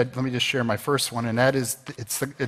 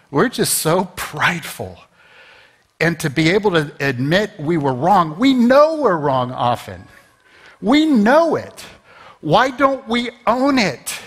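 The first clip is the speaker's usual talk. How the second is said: fervent preaching; 5 semitones higher, 4 dB louder than usual. Real, not acted. Preacher urges the congregation to admit wrongs, fervent. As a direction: preach it hard, urging them. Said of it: "am I preaching to myself".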